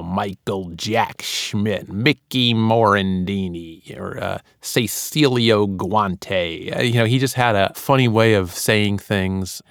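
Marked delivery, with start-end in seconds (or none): 0.0-4.0 slow, drawn-out announcer baritone; Harry Kalas impression. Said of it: "He would say". 4.6-6.8 very slow, drawn-out announcer name call; Harry Kalas impression. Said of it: "He would say".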